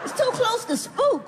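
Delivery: ordinary delivery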